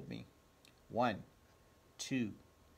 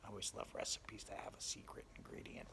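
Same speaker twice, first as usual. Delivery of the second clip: fast confiding whisper to the listeners; a quick conspiratorial whisper; whispered aside; 3 semitones lower, 13 dB quieter, faster than usual. Acted. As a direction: let the audience in on a secret. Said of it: "storyteller's whispered aside".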